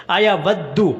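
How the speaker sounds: अयवद्दु recited with a clear oo ending; correct U ending. Shown as a demonstration. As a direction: finish अयवद्दु on u after the double D. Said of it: "अयवद्दो नहीं पढ़ना". (अयवद्दु के आख़िर में साफ़ पेश)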